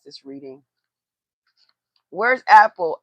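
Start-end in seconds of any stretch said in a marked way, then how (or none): 2.1-3.0 loud, higher call across the room. Real, not acted. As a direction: shout for someone out of sight. Calling out for her sister.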